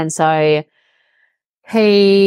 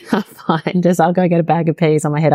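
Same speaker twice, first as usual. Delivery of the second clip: ordinary delivery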